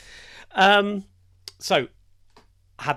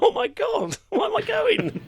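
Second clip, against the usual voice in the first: squeaky, much higher mock panic; 6 dB louder than usual. Called up for the jab, squeaky high mock panic. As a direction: yelp it up high like a fake alarm.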